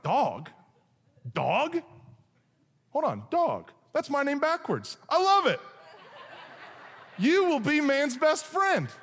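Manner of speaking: thrilled, like hearing your own name; delighted God. Acted.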